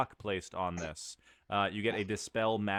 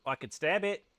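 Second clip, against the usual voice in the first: eager NPC voice; 8 semitones higher than usual. Acted. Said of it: "Bubble says".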